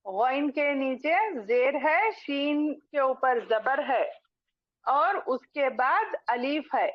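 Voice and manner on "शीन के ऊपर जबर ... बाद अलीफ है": ordinary delivery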